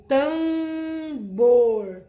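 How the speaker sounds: teacher holds up an example word for the students; example word emphasized. Shown as a demonstration. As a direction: spell out tambor loudly for the class. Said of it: "Três palavras com a vogal mais M".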